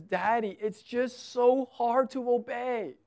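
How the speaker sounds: high, slow imitation of a crying little girl; retold tearful child. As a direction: lightly mimic a child's drawn-out plea. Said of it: "she was just crying".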